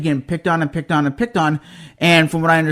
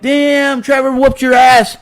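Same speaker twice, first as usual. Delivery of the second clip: mock friends jeering; 7 semitones higher, 4 dB louder, slower than usual. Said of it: "all his friends were like".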